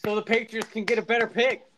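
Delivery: high, loud mock cheer. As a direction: cheer them on in jest. Mock cheering for rival team.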